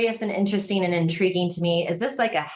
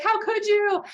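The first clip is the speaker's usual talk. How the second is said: high, hurt cry of disbelief, imitated; a retold partner's hurt, pitched up high; mock tearful reproach; 14 semitones higher than usual. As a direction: wail it lightly like a wounded partner. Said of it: "she's crying and she's like".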